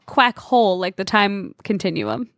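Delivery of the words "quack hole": "quack hole" as two words, a full "hole"; two separate words. Shown as a demonstration. Contestant correcting the misheard answer, "quack hole" as two clear words. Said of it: "It's a hole".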